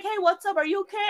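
high-pitched, concerned check-in; imitated concerned manager. Manager finds employee in the walk-in, imitated concern. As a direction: imitate a boss asking with concern.

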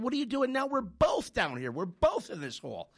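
why would you jump in here too; incredulous protest. Retold or imitated. Stuck man protests to his friend in the hole, incredulous.

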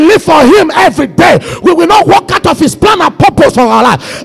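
full-force preaching. Real, not acted. Preacher declaring the hope of glory to the church, full-force preaching. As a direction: proclaim it high and forceful to the church.

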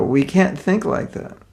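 ordinary talk, nothing marked

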